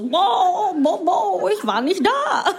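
high, drawn-out goofy blubbering; dopey, high fish-like impression; mock cooked fish. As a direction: babble like a hapless boiled fish, half laughing. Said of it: "Energie von so einem gekochten Fisch".

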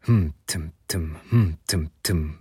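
low, rhythmic chug in syllables; imitated train. A narrator's reading. Train rolling along for hours, low rhythmic imitation. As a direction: mimic a train's steady rattle, low-pitched. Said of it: "machte der Zug".

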